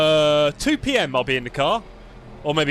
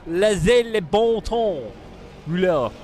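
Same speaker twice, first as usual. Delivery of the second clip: slow, higher-pitched fake French; 4 semitones higher, slower than usual. Shown as a demonstration. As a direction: stretch out the French phrase in an English-tinged French accent. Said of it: "How's my fake French".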